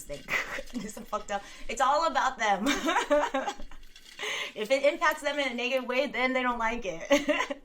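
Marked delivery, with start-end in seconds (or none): 2.7-4.5 a high, disbelieving laugh; scornful laugh. Real, not acted. Ranting about neighbor's politics, disbelieving laugh.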